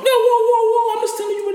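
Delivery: very high-pitched mock protest; imitated panicky climb-down; acted alarmed backpedal. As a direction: back off fast in a squeaky voice. Acts out backing off in argument.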